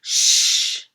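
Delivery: loud sharp shush; hushing. Acted. Brother hushing his sister, a sharp loud shush. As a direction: shush her hard. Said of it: "says Luke".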